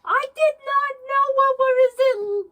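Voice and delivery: high, loud panicked yell; hammed-up frantic shouting; retold screaming. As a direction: yell it as if hurtling downhill blind. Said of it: "you were just screaming".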